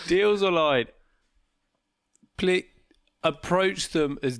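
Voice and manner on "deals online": loud, high mock slogan; sarcastic. Shown as a demonstration. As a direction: belt it out as a sarcastic tagline. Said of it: "with sarcasm".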